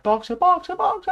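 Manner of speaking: rising, very high, loud cries; desperate calling. A narrator's reading. Clover galloping after the van, rising desperate cries. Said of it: "she cried".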